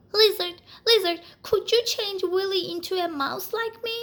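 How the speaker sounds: a mouse pleading for his friend; urgent plea. Acted. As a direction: beg the lizard in a rush. Alexander's plea.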